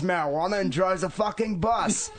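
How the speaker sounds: mock Australian bloke, over the top; exaggerated Australian accent, high and sing-song; bad Australian accent. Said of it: "worst accents humanly possible".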